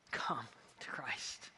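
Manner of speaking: slow, hushed invitation; whispered appeal. Real, not acted. A pastor inviting listeners to Christ, whispered. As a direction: breathe it like a secret plea.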